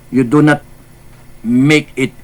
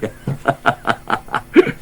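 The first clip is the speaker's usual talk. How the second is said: breathy laugh; 5 dB quieter than usual. Real, not acted. Laughs after apologizing.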